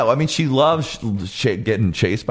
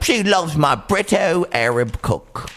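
slow, higher mock British accent; Adnan; 5 semitones higher, slower than usual. Boyfriend bragging about her, slow mock British accent. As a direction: stretch it out in a put-on British accent. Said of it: "he does have a British accent".

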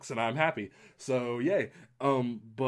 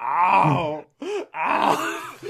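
loud, high hammed-up wails; mock wail; 7 semitones higher, 8 dB louder than usual. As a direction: wail like an overwhelmed wreck. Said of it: "I was a wreck".

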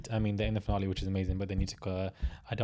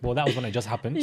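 ordinary talk

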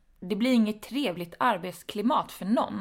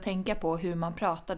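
normal talking voice

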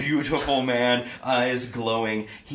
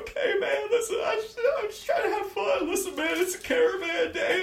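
very high, panicky gnome voice; squeaky scared gnome. Acted. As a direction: plead like a terrified little gnome.

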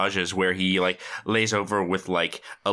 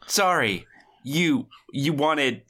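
higher, mildly barked mock fluster; half-yelled bark; 4 semitones higher, much slower than usual. Flustered student answering the woman he's stunned by, half-barking. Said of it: "half yell barks everything he says".

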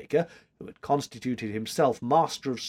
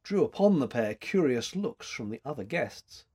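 usual speaking voice

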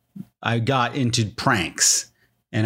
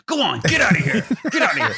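shooing a dog; 9 semitones higher, faster than usual. Retold or imitated. Journalist dismissing the cop like a dog, laughing mock shoo. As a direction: shoo him off like a stray dog. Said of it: "treating him like a dog at the end".

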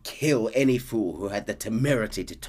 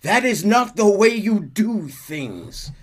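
Dutch; 9 semitones higher, 7 dB louder than usual. Acted. Dutch scolding the Braithwaites over the boy. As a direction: lecture the manor like a preacher. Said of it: "And Dutch says".

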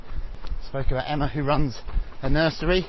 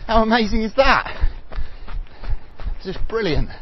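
excited; 8 semitones higher than usual. Real, not acted. Host celebrating Emma's 100K.

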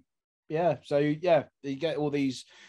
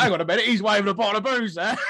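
high, louder, fast quote through laughter; laughing mock alarm; 5 semitones higher, 5 dB louder, faster than usual. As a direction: act out the alarmed onlooker, cracking up. Acts out soldier spotting booze, laughing.

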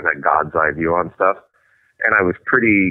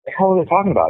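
mock bafflement; 10 semitones higher, faster than usual. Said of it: "I'll be".

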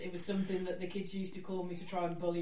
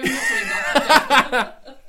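laughing; 6 semitones higher, 14 dB louder, much slower than usual. Real, not acted. Laughing refusal.

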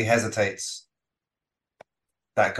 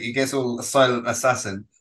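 ordinary talk

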